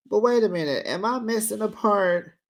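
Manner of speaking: normal talking voice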